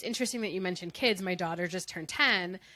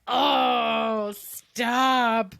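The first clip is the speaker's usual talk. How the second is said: loud, long-drawn mock groan of protest; exasperated outburst; 3 semitones higher, 8 dB louder than usual. Mother reacting to her daughter's sass, loudly exasperated. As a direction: stretch both words in exasperation.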